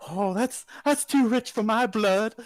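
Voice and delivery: bow out politely; lady folding. Retold or imitated. Lady at the table folds her cards, high and prim. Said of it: "she says".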